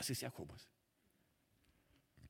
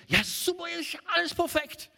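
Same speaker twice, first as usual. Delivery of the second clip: much higher, louder sarcastic delight; mock naive cheer; 12 semitones higher, 4 dB louder than usual. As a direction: play the naive newlywed, overly bright. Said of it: "hast eine Ehe und denkst".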